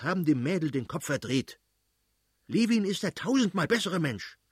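high-pitched, scornful tirade; acted irate father's rant; ranting angrily. A narrator's reading. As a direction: rail on in exasperated indignation. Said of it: "brauste der Fürst plötzlich auf und schrie".

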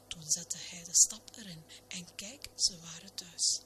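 ordinary delivery, nothing marked

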